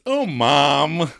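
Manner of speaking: put-on childish whine; like a little child. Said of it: "like a little child".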